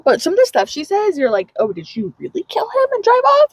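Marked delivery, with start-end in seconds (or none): 1.6-3.5 high-pitched incredulous question; mock shocked wondering. Reader wondering about Harper's past, high mock shock.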